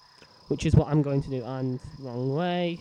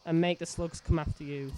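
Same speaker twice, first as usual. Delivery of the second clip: ordinary delivery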